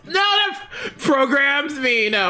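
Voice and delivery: high strained wail of a malfunctioning robot; mock anguished robot. Co-host mocks the robot's "him programs" pronouns with a wail. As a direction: cry it out like a glitching machine.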